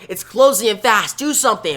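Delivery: urgent alarm. Acted. Wally trapped, field closing in.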